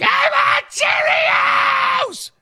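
put-on very high, slow wailing shout like a toddler; mock toddler tantrum. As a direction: squeal it like a kid on the grocery floor. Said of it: "spread eagle on the floor and they're like".

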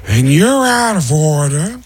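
high, drawn-out mock courtroom shouting; escalating hammed-up actor's tirade; Al Pacino. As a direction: build to a hugely stretched, high-pitched yell. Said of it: "as Al Pacino".

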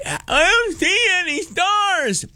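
put-on high, slow little kid complaint; child's voice. Son complaining to dad on the blanket, high child voice. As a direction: whine it like a small child. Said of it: "then it's like".

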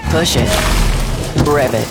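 normal talking voice

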